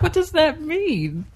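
mock confused listener. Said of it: "everybody's just like".

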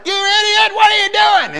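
high, loud shout at a motorcyclist; angry yell. Retold or imitated. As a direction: yell it out of the car window at him. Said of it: "And so I yelled at him".

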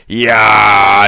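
loud alien roar; loud hostile reef voice; grinding reef roar. A narrator's reading. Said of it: "tremendous grinding noise".

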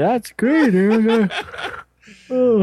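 high, loud mock enthusiasm; sarcastic. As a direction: gush with fake excitement. Mocking cohost's segment.

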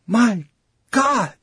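high, broken-up gasp of words; horrified exclamation. A narrator's reading. Colleague watching the corpse decay, horrified. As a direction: let horror split the phrase.

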